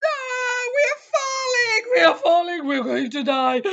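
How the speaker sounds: high, frantic cries of falling; terrified yelling; panicked cry. Acted. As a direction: scream it out as you plunge. Panic as the boat nosedives.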